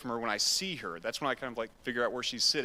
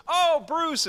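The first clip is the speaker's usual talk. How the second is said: higher, louder gushing hello; light imitation of a delighted young woman; delighted greeting; 6 dB louder than usual. Jane greeting the pastor at the restaurant, gushing. Girl greets old family friend.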